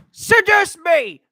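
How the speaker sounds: loud, high, drawn-out yell; shouted demand. Acted. Demanding to be seduced, a loud high shout. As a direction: belt it out slowly at full volume. Same line repeated as a shout.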